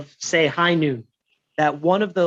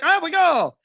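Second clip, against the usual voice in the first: mock surprise; 11 semitones higher than usual. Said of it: "I was like".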